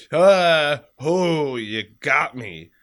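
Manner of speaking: loud, slow pained groan. Retold or imitated. Man voices himself when his back popped, pained. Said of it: "I'm like".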